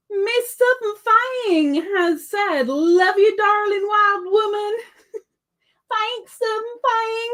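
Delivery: high, slow put-on Southern voice; hammed-up Southern sweet-talk; generic Southern accent. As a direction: play it up in drawn-out mock Southern. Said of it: "doing a generic Southern thingy".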